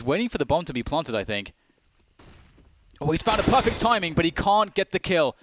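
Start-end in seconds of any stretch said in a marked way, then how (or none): 3.0-5.4 excited. Real, not acted. Caster excited at clutch play.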